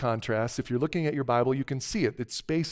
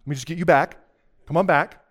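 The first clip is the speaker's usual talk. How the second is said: calling listeners back; 3 semitones higher, 7 dB louder, faster than usual. Real, not acted. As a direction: reel them back in with short, punchy phrases. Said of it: "let me encourage you to come back".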